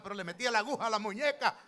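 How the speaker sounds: fervent preaching. Real, not acted. Preacher stirring the congregation about the wife's dolls. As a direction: preach it with rising force.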